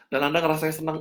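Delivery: ordinary delivery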